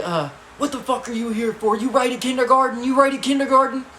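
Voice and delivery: high, loud, incredulous grilling; imitating an angry guard; guard's yelled questioning. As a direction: fire questions at a tiny kid, shouting. Guard grills the small boy.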